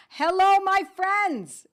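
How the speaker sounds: loud, high, slow hello to the whole room; big drawn-out greeting. Real, not acted. Host greeting her live viewers, loud and drawn out.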